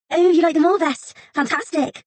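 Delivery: high, loud delighted squeal; thrilled she likes them. Acted. Assistant thrilled the scholar likes the cakes, high and loud.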